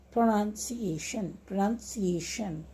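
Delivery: "pronunciation" slow but connected, without long gaps; "pronunciation" said slowly as one whole word; syllables joined up. Shown as a demonstration. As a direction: blend the five syllables of "pronunciation" together. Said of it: "after each syllable you can put a slash mark".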